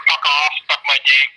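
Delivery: mock shouted insult. Quoting the Tourette's caller.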